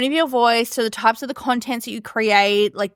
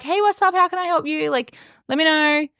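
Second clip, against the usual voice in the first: high, breezy casual greeting; casual greeter; 6 semitones higher than usual. Acted. Content creator greeting a client on a call, casual and breezy. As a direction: toss out a friendly, informal hello.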